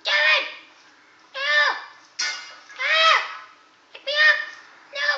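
candlestick crying for help. Acted. Candlestick pleads with David to pick it up. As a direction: plead like a dropped little candlestick.

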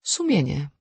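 one word pronounced firmly and clearly; hard and distinct. A narrator's reading. Emily Brent answers the doctors with one word, hard and distinct. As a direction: state it as a firm verdict. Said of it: "Wypowiedziała je twardo i wyraźnie".

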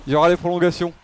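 ordinary delivery

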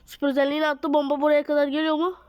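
ordinary delivery

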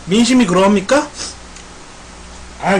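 usual speaking voice

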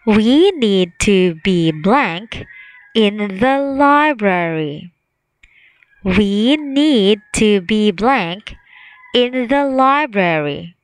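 slow, spaced reading of the sentence; slow quiz reading. Shown as a demonstration. Teacher reading the quiz sentence slowly to children. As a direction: read it out slowly for the class. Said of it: "reading quiz sentence slowly".

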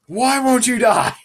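ordinary delivery

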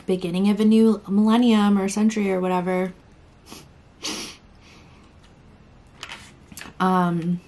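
talking around food in mouth; muffled speech between bites; tags: chewing while talking. Real, not acted. Seen 1: Took a bite at -12s; lips closed, chewing motions between words.

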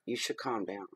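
quiet, teasing calm down; softly bait them into calming; mock calm.

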